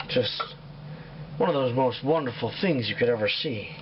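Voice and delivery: a hint of a misty-eyed dad explaining gently; tender father. Father answering his son's question, low solemn tender tone.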